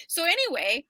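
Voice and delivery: normal talking voice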